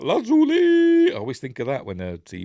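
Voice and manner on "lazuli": very high, slow, stretched-out call; a mock announcer stretching the name; drawn-out call. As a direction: sing the name out long and high. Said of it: "he goes like".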